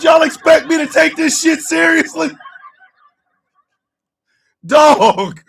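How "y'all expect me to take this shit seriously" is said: daring them to be serious, laughing; mock outrage. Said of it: "I said".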